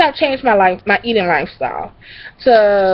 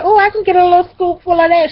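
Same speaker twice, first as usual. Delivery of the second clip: higher, sweet-talking ask; wheedling; 9 semitones higher, faster than usual. Retold or imitated. Asking cousin for mac and cheese, wheedling. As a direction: beg sweetly for a scoop. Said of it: "I said".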